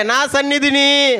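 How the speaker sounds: very high, slow shout. Shown as a demonstration. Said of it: "అరే, గట్టిగా అరవమంటుంటే". (ఇంకా గట్టిగా అరుపు)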